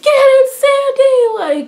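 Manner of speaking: high, loud, drawn-out fan scream; screaming cheer. Retold or imitated. Fan re-enacting cheering Sandy on at the TV, screaming. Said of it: "I was literally screaming at the T V".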